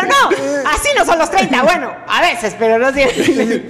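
mock protest. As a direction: protest in a put-on voice. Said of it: "Y yo".

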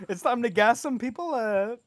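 high, loud camp influencer voice; hammed-up vlogger intro, swooping upward; James Charles. Co-host spoofing a beauty influencer as dictator, high camp voice. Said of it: "James Charles is Hitler?".

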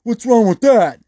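high, loud indignant whine; hammed-up angry tweaker protest; mock defensive outburst. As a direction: get offended and defensive like the biker. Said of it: "he would actually get mad at me".

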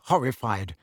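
usual speaking voice

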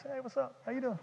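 very soft, casual greeting; unbothered duck. Acted. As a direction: toss off a cool, hushed hello. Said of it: "in the top, he's just".

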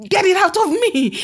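high-pitched mock plea to be freed; retold desperate plea. Possessed woman begging the man of God for deliverance, desperate retold plea. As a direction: cry out as the tormented woman, retold.

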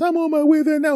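high, sing-song cheerful phone greeting; sweet high. Retold or imitated. Girlfriend on the phone, usually sweet and high. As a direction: chirp it up high like a happy girlfriend. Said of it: "it's more like".

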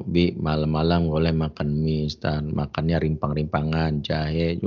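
lightly voiced wife, low and slow; mild mock admonishing, drawn out; istri menasihati. Wife's healthy-food reply, retold. (istri menasihati pelan, nada rendah)

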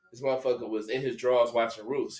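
ordinary delivery

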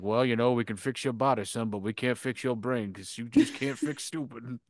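low, soft deadpan; a hint of a dry, tired father; mock dad. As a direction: drop it low like a weary dad. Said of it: "and he's like".

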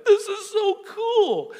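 put-on thrilled child's squeal, very high and slow; high-pitched ecstatic mock squeal; McKenna. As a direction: imitate an overjoyed little girl, loud and drawn out. Imitates thrilled daughter McKenna.

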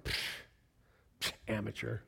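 low, soft mock contempt; dismissive scoff. Preacher privately judging the simple devotion advice, scoffing. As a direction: puff it away, then dismiss under the breath.

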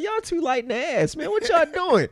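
high-pitched mock taunt; imitated taunting dad. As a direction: tease the kids as Pops would. Imitates Pops taunting while they jump him.

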